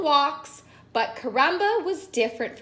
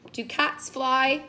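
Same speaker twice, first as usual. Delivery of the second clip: ordinary delivery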